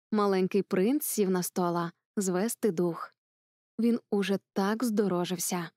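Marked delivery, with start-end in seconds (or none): none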